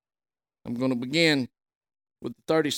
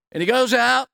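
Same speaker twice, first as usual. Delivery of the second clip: higher and louder burst; rising fervour; 8 semitones higher, 7 dB louder than usual. Real, not acted. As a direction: lift the congregation.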